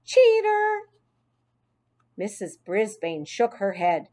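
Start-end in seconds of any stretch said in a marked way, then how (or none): 0.0-0.9 high, jeering retort; taunting child, building to a high shout; shooting back. A narrator's reading. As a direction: fling insults at your ex-friend. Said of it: "Heidi shot back".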